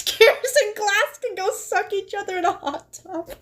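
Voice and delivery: high, giggling through the words; barely holding back laughter; laughing. Real, not acted. Reading her own crude line.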